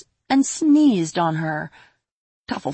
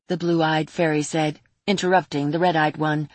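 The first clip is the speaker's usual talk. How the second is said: ordinary delivery, nothing marked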